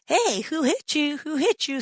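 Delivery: high, taunting jeer of a soldier; mocking soldiers. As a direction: taunt him like a cruel guard. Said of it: "they were saying".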